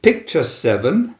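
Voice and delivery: usual speaking voice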